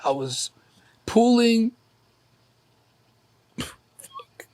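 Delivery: choked up, pitch pushed high; real crying. Real, not acted. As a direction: fight back tears while talking, voice tight. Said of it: "I think that's why I'm crying".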